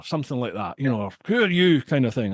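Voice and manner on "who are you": sharp who are you called down the stairs; calling out to strangers; mock shout. Bouncer calling down to two strangers, a mild shout. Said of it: "I shouted at them".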